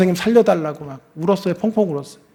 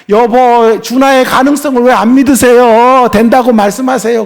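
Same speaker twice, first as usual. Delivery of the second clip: raised, higher voice pressing her husband; loud and pitched up, demanding he believe; wife's insistent plea; 6 semitones higher, 10 dB louder than usual. Retold or imitated. Wife urging her husband to believe in their son, raised and high.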